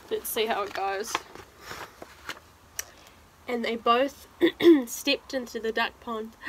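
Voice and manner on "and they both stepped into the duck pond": animated, louder storybook telling; reading to children. Acted. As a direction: dramatise the climax for young listeners. Reads picture book aloud.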